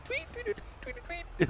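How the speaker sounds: very high-pitched bird chirps; imitated bird tweeting. Vlogger recalling a game's birdsong, imitated high tweets. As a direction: mimic a bird's piping tweet. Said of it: "and the bird's going".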